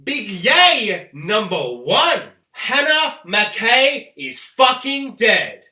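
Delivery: loud, slow triumphant shout; slow, booming reveal; shouted announcement. Real, not acted. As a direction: bellow it out like a winner. Loud announcement of big yay.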